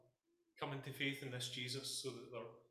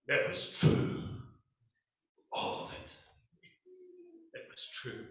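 quoting Han Solo; 7 dB louder, slower than usual. Retold or imitated. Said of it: "the best line in any Star Wars film".

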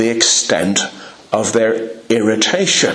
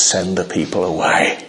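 gentle voice; 6 semitones lower than usual. Shown as a demonstration. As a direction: ask kindly, almost apologetic. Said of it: "Was it a gentle voice?".

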